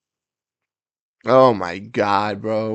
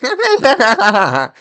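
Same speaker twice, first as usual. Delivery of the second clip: high burst of real laughter; loud laugh; 11 semitones higher, 9 dB louder than usual. Real, not acted. Big laugh at a joke.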